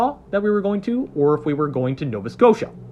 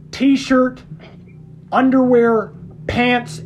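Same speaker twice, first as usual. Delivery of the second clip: usual speaking voice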